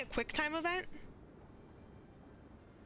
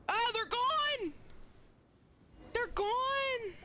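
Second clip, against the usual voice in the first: excited shout; 9 semitones higher, much slower than usual. Real, not acted. Shocked the party vanished.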